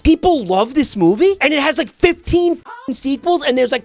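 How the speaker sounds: rising exasperated rant; scathing critique. Real, not acted. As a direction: tear into the movie. Ranting about the movie.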